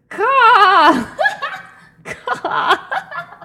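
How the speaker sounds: loud high screeching caws; shrill screeching bird woman. Pretend. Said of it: "I'm a really annoying bird".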